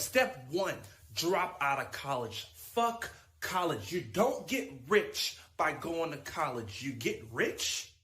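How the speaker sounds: ordinary talk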